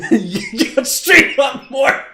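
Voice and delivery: slow, loud and high through laughter; laughing. Real, not acted. Streamer laughing at a viewer's joke, words through laughter. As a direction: get the words out while cracking up.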